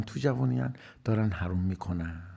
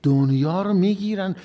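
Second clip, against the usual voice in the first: exclaiming; 7 semitones higher, 10 dB louder than usual. Acted. Exclaimed punchline.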